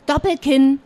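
usual speaking voice

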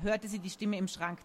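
ordinary delivery

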